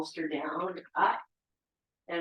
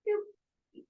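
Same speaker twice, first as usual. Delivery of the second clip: high playful doop sound. Real, not acted. Quick demo sound.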